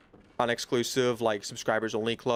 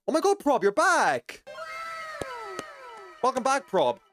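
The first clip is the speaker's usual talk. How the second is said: joyful reunion. Real, not acted. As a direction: greet a returning friend, thrilled. Viewer returns to stream.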